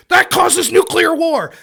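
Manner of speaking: high, loud, slowly stretched mock alarm; hyperbolic outburst. Mocking conservative debaters' exaggeration, loud and hyperbolic. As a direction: proclaim it like an absurdly overblown catastrophe. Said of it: "it's hyperbolic".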